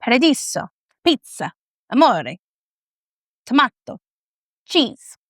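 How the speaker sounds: playful put-on Italian accent, word by word; hammed-up Italian list. Shown as a demonstration. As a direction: lean into Italian vowels, pausing between words. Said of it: "do my Italian heritage justice".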